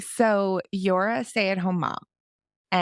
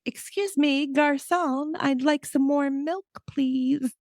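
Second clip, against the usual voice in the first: high, prim fake-fancy child voice; bossy little daughter; 10 semitones higher, slower than usual. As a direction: order milk like a tiny posh diner. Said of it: "she was like".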